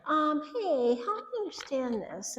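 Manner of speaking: high, sugary patronizing tone. Shown as a demonstration. Wife to husband, mock-sweet and patronizing. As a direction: fake sweetness, talking down. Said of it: "not in a patronizing way".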